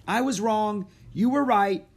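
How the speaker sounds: high, loud, delighted admission; excited and high-pitched; thrilled. A narrator's reading. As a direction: burst out with it, thrilled. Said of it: "She sounded thrilled".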